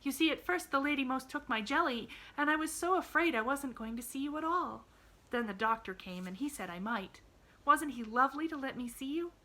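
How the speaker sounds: put-on high-pitched chattering child; glad little girl, eager. A narrator's reading. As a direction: gush happily in a high girlish voice.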